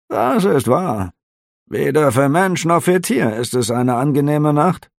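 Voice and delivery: high, curious innkeeper; a nosy innkeeper asking lightly; innkeeper's voice. A narrator's reading. Innkeeper questioning the officer, high and curious.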